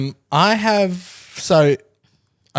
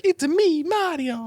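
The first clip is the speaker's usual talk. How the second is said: high, bouncy cartoon plumber voice; squeaky Italian plumber catchphrase; Mario impression. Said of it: "You know, where it's like".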